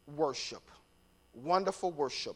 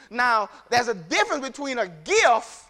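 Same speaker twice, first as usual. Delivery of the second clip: normal talking voice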